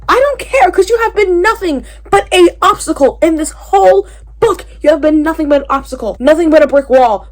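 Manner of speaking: loud and high, telling him off; furious rant at a book character; fed-up rant. Real, not acted. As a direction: scold Grayson in full frustration. Ranting at a character.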